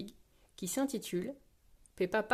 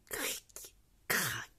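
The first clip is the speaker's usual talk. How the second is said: voiceless creak and crack; imitated creaking; 7 semitones lower, 9 dB quieter than usual. A narrator's reading. Chairlift creaking under Papa Pig, imitated cracks. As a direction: make the sharp cracking noises softly. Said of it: "des craquements du télésiège".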